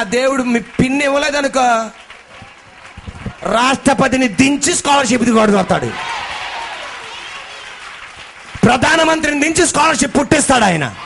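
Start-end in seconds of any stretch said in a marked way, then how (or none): 3.4-10.9 loud, slow, soaring preacher's cry; fiery preaching. Acted. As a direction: proclaim the miracle at full force. Preaching climax.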